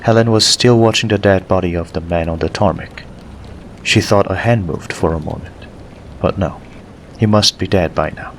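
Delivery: usual speaking voice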